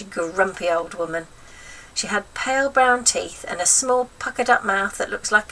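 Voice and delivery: ordinary delivery, nothing marked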